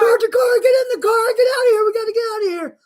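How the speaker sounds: high, loud panicked yell; frantic commercial shout. Retold or imitated. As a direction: shout like a parent fleeing in an ad. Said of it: "where they say".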